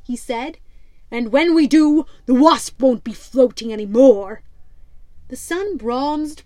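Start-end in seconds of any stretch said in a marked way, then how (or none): 1.1-4.4 louder, gruff pirate captain scoffing. Acted. Pirate captain mocks the rival ship, loud and scornful.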